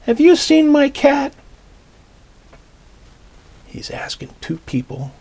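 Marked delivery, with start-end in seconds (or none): 0.1-1.3 high, loud, urgent call. Acted. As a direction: call out to strangers on a bench. Boy calls out searching again.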